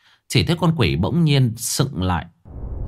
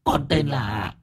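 whining ghost voice; 5 semitones higher, 4 dB quieter, slower than usual. A narrator's reading. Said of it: "Con Vong mếu máo".

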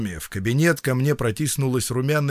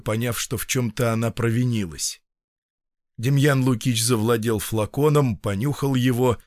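ordinary delivery, nothing marked

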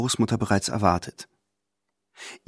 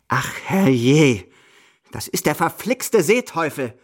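shrieking; 4 semitones higher, slower than usual. Acted. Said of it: "kreischt Großmutter".

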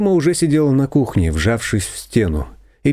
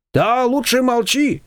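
higher, slow agreeing voice; confectioner agreeing; 13 semitones higher, slower than usual. A narrator's reading. Confectioner siding with Shushana, higher and slow. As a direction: nod along slowly.

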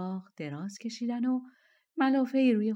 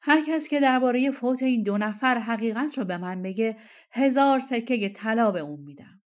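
loud, slightly raised royal announcement; loud proclamation; 3 semitones higher, 8 dB louder than usual. A narrator's reading. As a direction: proclaim it to the whole room. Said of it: "سلطان با صدای بلند گفت". (اعلام با صدای بلند)

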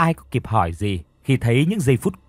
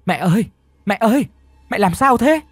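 high, loud frantic cry; calling loudly; 9 semitones higher, 4 dB louder than usual. A narrator's reading. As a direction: shout for mother in panic. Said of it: "tiếng con trai gọi lớn".

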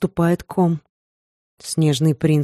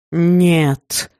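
barked no. A narrator's reading. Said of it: "рявкнул он так".

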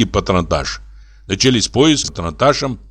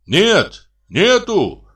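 high, drawn-out answering call; calling back; 10 semitones higher, much slower than usual. A narrator's reading. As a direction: shout the answer back from afar. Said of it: "крикнул Кардамонов". (высокий протяжный ответный окрик)

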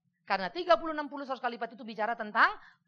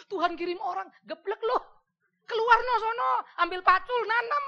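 rising scold, louder and higher to a shout; 11 semitones higher, 7 dB louder than usual. Acted. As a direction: bark at a lazy believer to get up and work. Pendeta menegur jemaat malas. (teguran keras sambil berteriak)

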